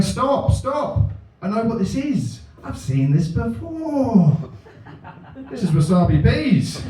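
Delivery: high, urgent calls of stop; mild mock shout. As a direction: burst in calling a halt. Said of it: "He's shouting".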